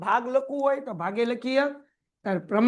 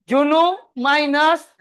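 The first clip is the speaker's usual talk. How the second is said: slow drawn-out prompt, rising at the end; prompting slowly; 3 semitones higher, 7 dB louder, slower than usual. Shown as a demonstration. Teacher prompting the class to complete it, slow. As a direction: stretch it out so they finish it. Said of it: "બસ એટલું જ".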